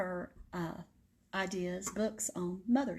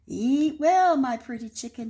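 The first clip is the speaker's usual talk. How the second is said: wolf calling out; 4 semitones higher, 7 dB louder than usual. Acted. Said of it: "he cried".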